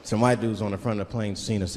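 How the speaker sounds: usual speaking voice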